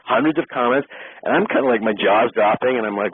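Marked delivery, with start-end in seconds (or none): none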